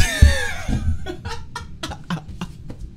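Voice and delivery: shrieking high laugh; hysterical high laughter. Real, not acted. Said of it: "I bursted out laughing".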